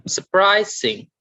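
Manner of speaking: "surprising" with PRIS high and drawn out; stress on middle syllable. Shown as a demonstration. Teacher reading out the middle column, middle stress on "surprising". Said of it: "stress on the middle".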